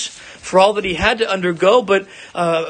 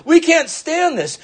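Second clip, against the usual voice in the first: higher, slow, a touch of mock dread; lightly voiced terror of the people at Sinai; frightened people; 12 semitones higher, 3 dB louder, slower than usual. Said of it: "and they said".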